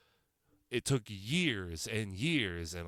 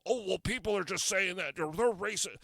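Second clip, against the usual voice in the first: mocking critics; 6 semitones higher than usual. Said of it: "People could sit there and go".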